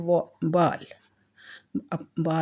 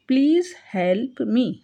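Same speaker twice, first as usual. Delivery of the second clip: very slow and higher, word by word; slow teaching read; 5 semitones higher, 3 dB louder, much slower than usual. Shown as a demonstration. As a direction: read it slowly for students. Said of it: "teacher reads phrase". (धीरे-धीरे पढ़कर)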